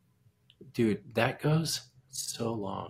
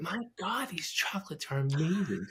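breathless whispered gushing, higher; awed whisper; 6 semitones higher than usual. Retold or imitated. Delta lady gushing to him about the chocolates, awed whisper. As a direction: whisper it like the chocolates stunned you.

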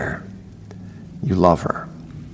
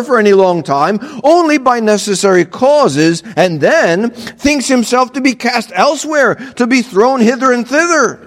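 raised, reading-aloud voice; reading a quote aloud; 10 semitones higher than usual. Acted. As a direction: read the old text out. Reads quoted commentator.

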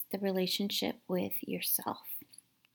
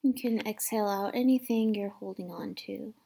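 gentle guided meditation voice, partly whispered; calm, hushed meditation guide. Acted.

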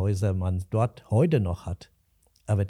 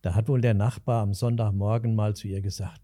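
ordinary talk, nothing marked

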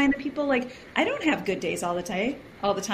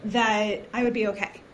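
ordinary delivery

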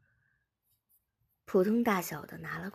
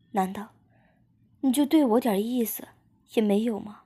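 louder, higher-pitched emotional outburst; acted pleading with raised voice; impassioned reproach; 4 semitones higher, 5 dB louder than usual. A narrator's reading. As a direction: build into a hurt, insistent demand. Emotional confession, reproaching the loved one.

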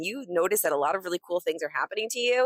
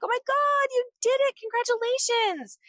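squealing, much higher, louder congratulations; cheering friends; 4 dB louder than usual. Retold or imitated. Imagined friends calling to celebrate her, high squealing cheer. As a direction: shriek it like thrilled friends on the phone. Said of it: "they're like".

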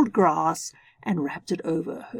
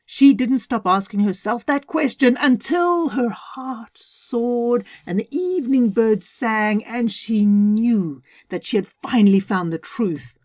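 ordinary delivery, nothing marked

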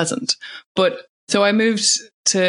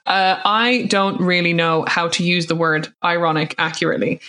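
normal talking voice

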